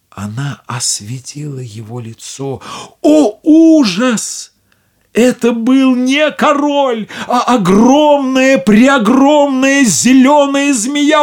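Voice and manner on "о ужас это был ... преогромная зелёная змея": high, loud, slow horrified narration; horrified exclamation. A narrator's reading. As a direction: gasp out the terrible reveal. Narrator reveals the snake.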